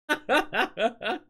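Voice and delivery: high bouncy laugh. Real, not acted. Laughs after admitting she teased them.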